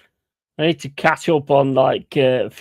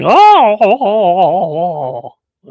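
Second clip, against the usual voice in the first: high, loud cat yowl sliding down; imitating a yowling cat; 7 semitones higher, 9 dB louder, slower than usual. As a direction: mimic the cat wailing before being sick. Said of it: "starts shouting and going".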